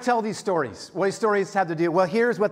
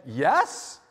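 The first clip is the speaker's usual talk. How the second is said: hesitant yes with a questioning lift; unsure questioning yes; 3 semitones lower than usual. Retold or imitated. To the brown belt stranger, a doubtful questioning yes. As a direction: answer like you're not sure it's right. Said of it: "Kinda like a question mark".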